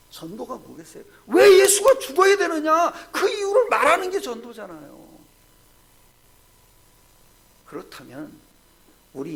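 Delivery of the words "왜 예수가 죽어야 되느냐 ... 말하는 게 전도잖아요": loud, crying preacher's call; preaching shout. Real, not acted. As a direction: cry it out to the congregation. (목소리를 높여 외치는 설교)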